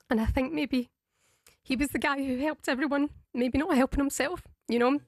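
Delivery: trying not to cry on air; holding back tears. Real, not acted. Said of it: "I can see how upset you are".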